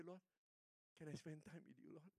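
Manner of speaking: usual speaking voice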